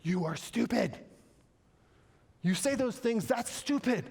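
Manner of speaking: high, hammed-up scolding; God scolding from above. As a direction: play God calling out foolishness. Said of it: "God's looking down, he's going".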